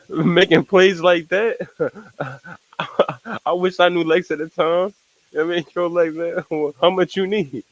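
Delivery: giggling at the idea of scheming with Lex; laughing. Real, not acted. Streamer laughing while imagining hustling Lex.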